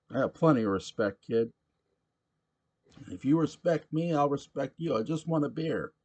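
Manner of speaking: put-on loud, high rowdy drunk voice; a belligerent barfly demanding his beer; drunk pushy patron. Sam answers and argues.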